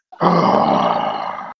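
long low groaning sigh; deep sigh. Real, not acted. Fan despairing at the team, long low sigh. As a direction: let out a heavy despairing sigh.

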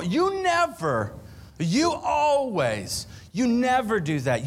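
high, slow whiny "you never, you always"; mocking accusing spouse. Pastor mimics a spouse in a fight, whiny high accusation. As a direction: mimic a nagging spouse, dragging it out. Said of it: "Never and al".

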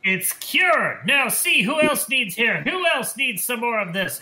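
snake-oil salesman. Acted. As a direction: sell it like a carnival quack hawking a cure. Salesman hawks more tonic.

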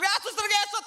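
high-pitched startled cry; mock fright. Passer-by spots a crawling nurse ghost, mock fright. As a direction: blurt it out high, as if spooked.

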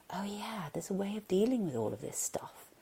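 soft, near-whispered realisation; hushed inner voice. Acted. Part of the brain suddenly remembering, hushed. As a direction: murmur it as a private thought.

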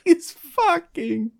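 high, slow mock-crying; horrified sobbing wail. Onlooker mourning a gruesome death, hammed-up wail.

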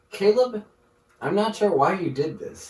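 imagine tasting a dud and complain; mock complaint. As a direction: pretend disappointed taster. Imagined complaint after tasting the food.

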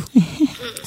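short real laugh; a quick laugh at her own story. Real, not acted.

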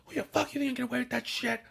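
fast, high mock road rage yell; fast imitated yell at the next car; imitating uncle yelling. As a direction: hammer it out like a furious driver. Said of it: "he would scream at them".

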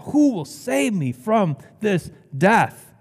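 slow, high-pitched anguished plea; anguished crying out. Acted. Preacher acting Paul crying out for rescue, anguished. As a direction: draw out each word of a desperate question. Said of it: "Paul cries out".

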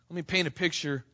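ordinary talk

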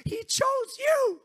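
shouted high and slow, hitting you hard; preaching shout. Acted. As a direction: proclaim it to the back row. Preaching climax.

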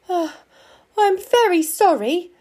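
high, loud startled apology; flustered fox. A narrator's reading. Fox apologising to a talking stone lion, flustered.

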